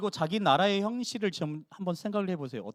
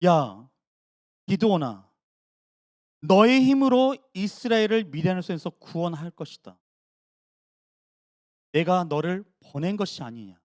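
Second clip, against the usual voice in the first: bold, louder call from God; God commanding the timid Gideon; 5 dB louder than usual. Retold or imitated. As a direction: summon Gideon with authority. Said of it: "하나님이 말씀하고 있는 거예요".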